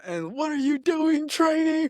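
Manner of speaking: high mocking sergeant voice; mock sergeant taunt; imitating drill sergeant. Sergeant questioning recruit.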